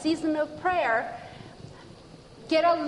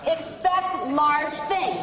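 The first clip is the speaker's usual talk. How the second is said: slow and emphatic; 3 semitones higher, much slower than usual. Real, not acted. Charging listeners to expect blessings.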